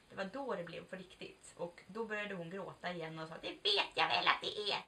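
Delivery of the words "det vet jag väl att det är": loud breathy half-sob of a mother; imitating crying mom. Mother crying to daughter who just came out, mock sobbing. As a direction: do mom weeping through the words. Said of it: "varje gång som jag försöker prata som min mamma så blir det så".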